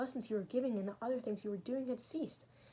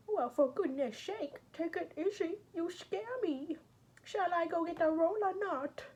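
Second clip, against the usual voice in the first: the maid Natasha, teasing and alarmed, pitched high; high maid's voice; 9 semitones higher, 5 dB louder, slower than usual. Acted. Natasha the maid fussing at Raskolnikov, high teasing voice. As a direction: play the chatty maid, voice lifted well above your own.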